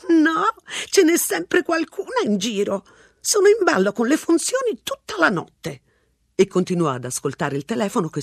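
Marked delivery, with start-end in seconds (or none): 0.1-5.8 put-on a rambling old lady, unbothered; old woman voice. A narrator's reading. Old woman at the payphone chatting, high and unhurried. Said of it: "aggiunse per chiarire".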